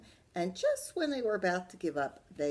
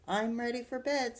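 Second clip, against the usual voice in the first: mild sweet little sister voice; a touch of goody-goody sweetness; sweetly; 6 semitones higher, 4 dB louder than usual. Acted. D W showing off to Dad, mildly sweet. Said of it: "said D W sweetly".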